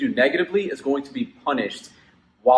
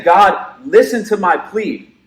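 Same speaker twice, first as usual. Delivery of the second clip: pleading with God; 5 semitones higher, 5 dB louder, slower than usual. Acted. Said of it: "So he's saying".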